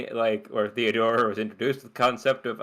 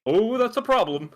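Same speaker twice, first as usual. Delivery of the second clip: mock alarm; 11 semitones higher than usual. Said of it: "It was like".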